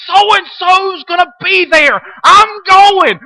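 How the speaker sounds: high, slow, gushing fan voice; mock the starstruck churchgoer; mock excitement. Mocking eager churchgoers' quote.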